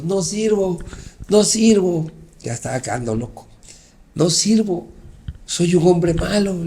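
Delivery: a half-voiced shout of despair, slowing; retold cry to God. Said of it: "le grité a Dios". (gritándole a Dios, a medio volumen)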